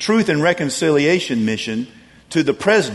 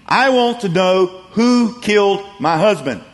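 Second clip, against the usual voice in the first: mock scream; 6 semitones higher, 4 dB louder than usual. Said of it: "and screamed".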